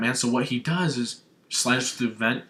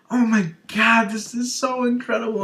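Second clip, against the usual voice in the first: high, loud fanboy squeal; overwhelmed fan, pitched way up; freaking out; 11 semitones higher, 5 dB louder than usual. Retold or imitated. Acts out freaking out at trailer.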